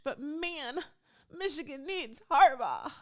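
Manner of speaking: high, hammed-up fan's plea; pleading lament. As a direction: whine it out like a heartbroken fan. Pleads for coach to stay.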